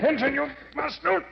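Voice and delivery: frightened strangled plea; desperate plea. Acted. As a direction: plead as you are smothered. Randor being smothered, pleading.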